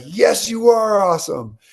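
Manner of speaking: loud, high, stretched-out cheer; imitated exuberant scream. She stands up in the restaurant to answer, mock screaming. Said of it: "stand up and scream".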